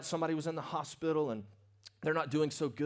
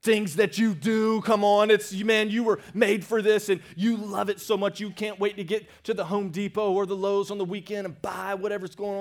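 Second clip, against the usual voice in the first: louder and higher, fired up; rising fervor; 4 semitones higher, 7 dB louder than usual. Real, not acted. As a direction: build the energy on the gardener. Preacher building excitement.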